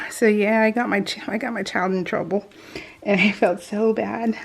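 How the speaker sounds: usual speaking voice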